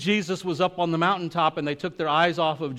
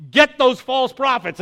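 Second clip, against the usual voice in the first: loud, high call to seize them; rally the crowd with a shout; commanding shout; 7 semitones higher, 8 dB louder than usual. Retold or imitated. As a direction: Elijah ordering the crowd to seize the false prophets, loud and commanding. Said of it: "And then he goes".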